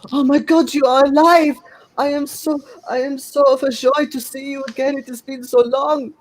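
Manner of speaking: put-on overjoyed French accent, very high and loud; thick French accent. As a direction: cry out a joyful reunion in a heavy French accent. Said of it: "saying in a very thick French accent".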